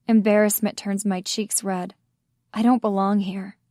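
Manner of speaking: ordinary delivery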